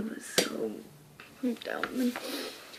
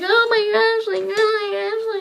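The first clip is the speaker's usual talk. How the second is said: mock crying; 9 semitones higher, 10 dB louder than usual. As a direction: wail as if about to cry. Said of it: "Well, I didn't cry, but".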